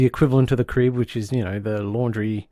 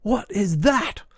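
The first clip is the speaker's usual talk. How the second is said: shocked; 7 semitones higher, slower than usual. Retold or imitated. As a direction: ask in stunned disgust. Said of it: "And Missus Marsden goes".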